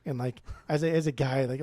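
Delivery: ordinary delivery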